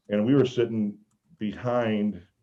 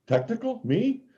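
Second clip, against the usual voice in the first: mock innocence; 13 semitones higher than usual. Feigning innocence to the ref.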